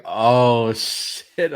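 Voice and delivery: loud, drawn-out "oh" of realization; exaggerated embarrassed dismay; mock dismay. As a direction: groan it out as it dawns on you.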